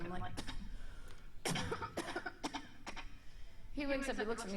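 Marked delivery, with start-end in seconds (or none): 0.4-3.7 raspy choking coughs. Retold or imitated. Choking demonstration.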